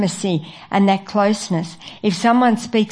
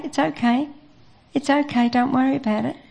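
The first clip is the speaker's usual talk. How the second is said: soothing; 5 semitones higher, 3 dB quieter, faster than usual. Acted. Friend soothing a troubled person.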